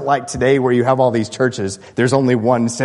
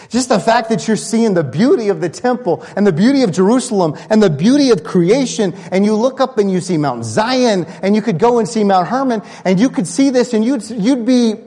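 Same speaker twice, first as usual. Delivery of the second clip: rising excitement; 8 semitones higher than usual. Real, not acted. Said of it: "imagine what you would have seen".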